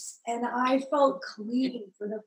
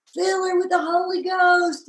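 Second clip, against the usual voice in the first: fervent prayer; 7 semitones higher, 5 dB louder than usual. Retold or imitated. Congregation praying over her.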